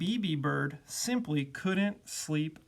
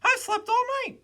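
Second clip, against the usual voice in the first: very high, loud little bird voice; high-pitched chirpy bird character; 5 dB louder than usual. A narrator's reading. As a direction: squeak it out as a tiny bird. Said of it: "said the Bee".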